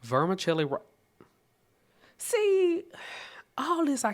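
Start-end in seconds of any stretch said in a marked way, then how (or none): 2.2-4.1 high squeak. Real, not acted.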